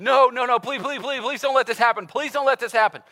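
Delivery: high, loud desperate pleading; begging servant. Retold or imitated. Servant begs the king to spare him, pleading loudly. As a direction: beg for mercy on your knees. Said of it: "begins to beg the king".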